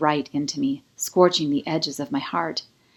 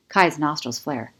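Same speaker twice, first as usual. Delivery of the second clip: ordinary delivery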